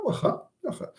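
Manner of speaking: understated threat on "waha"; threatening acceptance. Shown as a demonstration. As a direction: say "waha" as a warning of payback later. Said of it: "you threaten him that you will retaliate".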